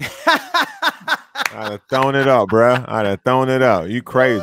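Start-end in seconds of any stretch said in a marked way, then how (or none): 0.0-2.8 laughing. Real, not acted. Laughing while repeating himself.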